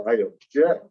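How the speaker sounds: "object" stressed on JECT, as the verb; "object" rising onto a strong second syllable. Shown as a demonstration. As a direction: demonstrate "object" as ob-JECT, light first syllable. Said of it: "it's pronounced".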